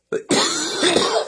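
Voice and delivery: sudden choking cough; coughing fit. Real, not acted. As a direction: cough hard, choking on coffee. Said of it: "I'm choking on coffee".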